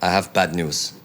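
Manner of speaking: imitated Eastern European doctor accent; mock Hungarian-accented doctor; Doctor Sarah's accent. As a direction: deliver bad news in a Budapest accent. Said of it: "Doctor Sarah comes out and she says".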